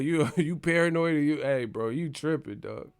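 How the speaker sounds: ordinary delivery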